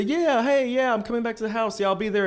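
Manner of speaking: high, upbeat voice faking a phone chat; fake cheerful phone call. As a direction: sound breezy to fool a friend. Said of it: "I pretend to call the housemaid".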